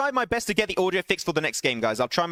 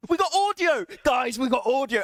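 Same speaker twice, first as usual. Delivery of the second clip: excited; 6 semitones higher than usual. Real, not acted. Stream audio finally works.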